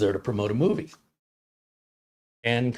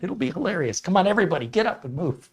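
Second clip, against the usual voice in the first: higher-pitched, urging the crowd along; egging on; 7 semitones higher, faster than usual. Retold or imitated. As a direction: imitate a comic rallying an audience into a prank. Comic eggs on audience into prank.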